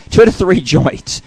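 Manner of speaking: amused, broken by a real laugh. Real, not acted. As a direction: crack up while finishing the line. Laughs at own flubbed line.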